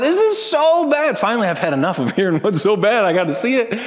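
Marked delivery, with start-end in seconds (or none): 0.0-1.2 put-on high, slow, drawn-out child's whine; whiny child. Daughter whining to herself in the other room, high and drawn out. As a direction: whine it like a four-year-old over a broken toy. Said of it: "she just whines".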